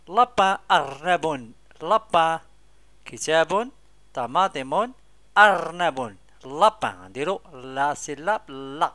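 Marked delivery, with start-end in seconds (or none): 0.1-7.0 loud classroom drill. Shown as a demonstration. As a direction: call each word out loudly. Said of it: "loud drilling of words".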